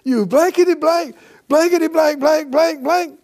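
high, loud pretend swearing; hammed-up rant at a car that won't start; mock angry cussing. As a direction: rattle off stand-in curses in mock rage. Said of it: "cuss it".

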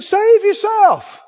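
high, loud, drawn-out taunt; mocking. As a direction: jeer it out slowly, way up high. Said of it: "Mock it".

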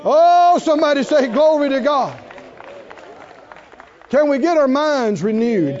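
preacher shouting to the congregation for an answer; preaching call-out. Real, not acted. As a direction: lift the room, call them to answer. Preacher calls for congregation response.

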